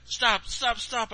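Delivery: high, loud desperate pleas; crying out. Acted. Boy pleading with Santa to stop, high loud cries. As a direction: beg him to stop, covering ears. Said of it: "I cried".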